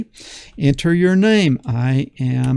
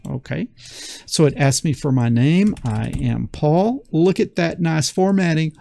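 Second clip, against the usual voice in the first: ordinary delivery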